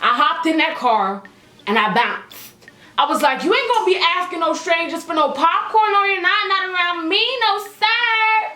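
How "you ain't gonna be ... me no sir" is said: high-pitched, indignant scolding; sassy telling-off. Retold or imitated. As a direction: lay down the law in a high voice. Acted scolding of her date.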